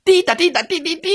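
high, fast tooting; imitated toy horn. A narrator's reading. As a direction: toot quickly like a little horn. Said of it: "小红帽又拿起了他的小喇叭".

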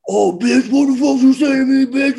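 imitating a furious teammate; mock angry cussing. Teammate imitating Warren cussing out the stewardess, mock angry. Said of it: "he cussed her".

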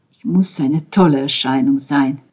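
plain reading voice

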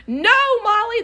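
high, loud mock shriek; imitated scream. Alina screaming at the three-legged dog, imitated scream. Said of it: "Alina screaming".

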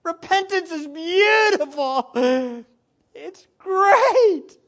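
high, loud laughing cry; joy spilling over into tears; overjoyed preacher laughing and crying at once; laughing through tears. Real, not acted. Emotional joy in sermon.

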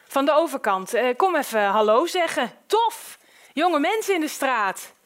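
boisterous man barging in with a greeting; hearty overbearing neighbour. Acted. Man from across the street greets new neighbours, hearty.

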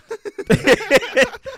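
loud high-pitched laughter; big shrieking laugh. Real, not acted. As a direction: burst out laughing.

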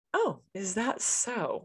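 drawn-out coy fake surprise; mock innocent. Mocking date's suggestive reply.

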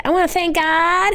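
high, mock award-winner gush; acceptance speech. Mimicking celebrities thanking God in acceptance speeches, high mock gush. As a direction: imitate an excited award winner. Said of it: "in acceptance speeches, they say".

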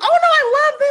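loud, very high mock gushing; imitated gushing. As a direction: gush it way up high, hammed-up. Imagined gushing grandmother retold.

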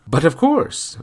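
bright, lilting French mother; light, cheerful maternal voice; Margot's voice. A narrator's reading. Margot speaks in story.

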